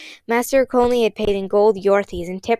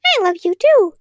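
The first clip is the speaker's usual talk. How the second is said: much higher, louder bird-like chirp; chirpy voice; 12 semitones higher, 7 dB louder than usual. Acted. Girl throws a chirpy voice above their heads. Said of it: "a chirpy voice".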